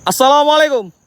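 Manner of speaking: loud high call into the dark; greeting shouted across the graves. Real, not acted. Repeated calls to spirits.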